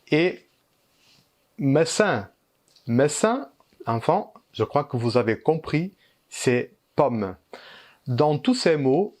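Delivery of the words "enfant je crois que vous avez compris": ordinary delivery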